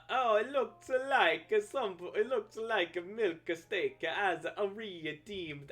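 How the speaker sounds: mock Italian drawl stretched out with uh fillers; obnoxious Italian accent. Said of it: "doing as obnoxious an Italian accent as I".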